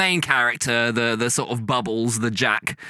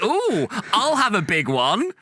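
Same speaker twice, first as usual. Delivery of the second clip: slow, high suggestive ooh; camp panto line; 7 semitones higher, slower than usual. Retold or imitated. Footballer imagined playing to the crowd, camp and slow. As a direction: tease it like a panto dame. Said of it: "go to the crowd and be like".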